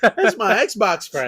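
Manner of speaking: slow, high, laughing quote; mock the line while cracking up; laughing mockery.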